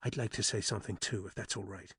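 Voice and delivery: soft, hushed request; whispered. A narrator's reading. Alice asking Dan privately to speak, hushed. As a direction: say it low, just for his ear. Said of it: "she caught his hand and whispered".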